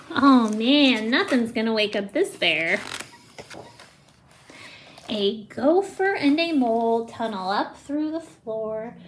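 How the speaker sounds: plain reading voice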